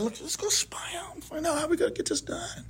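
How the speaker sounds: hushed secret-plan whisper; acted-out furtive whisper; conspiratorial whisper. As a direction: whisper it like plotting a covert mission. Moses quietly proposing a spy mission.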